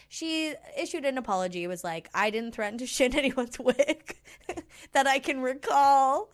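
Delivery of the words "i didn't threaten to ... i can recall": higher, louder, breaking into laughs; laughing mock quote. Podcaster retelling an absurd apology to co-host, laughing. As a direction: quote it while cracking up.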